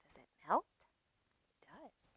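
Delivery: normal talking voice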